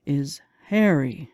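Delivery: ordinary delivery, nothing marked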